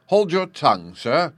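ordinary delivery

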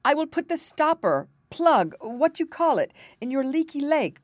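lightly voiced prince, high and loud; insistent prince. A narrator's reading. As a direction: press the offer on the king. Prince loudly insists to raging king.

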